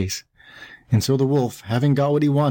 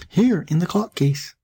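put-on high, slow, small kid voice; little voice crying out; 7 semitones higher than usual. A narrator's reading. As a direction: call from hiding as a tiny kid. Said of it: "a little voice cried".

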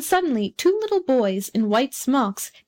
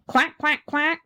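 higher-pitched duck quacks; duck quacking; 5 semitones higher, slower than usual. A narrator's reading. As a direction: quack away in a raised duck voice.

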